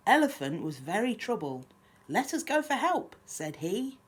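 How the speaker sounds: lively, lilting picture-book reading; children's story narration. A narrator's reading. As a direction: read a rhyme aloud to little ones. Reads picture book aloud.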